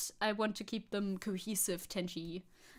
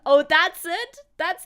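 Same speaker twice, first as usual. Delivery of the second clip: sudden high, loud realisation; loud surprise; 6 semitones higher, 12 dB louder, slower than usual. Real, not acted. Streamer catching on to the accent joke, loud surprise. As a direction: burst out in surprised recognition.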